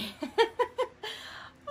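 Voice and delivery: high, breathy squeal of a laugh. Real, not acted. To viewers over the fall-off-the-bone rib, a squealing laugh. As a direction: let out a delighted squeal. Tasting the short rib on camera.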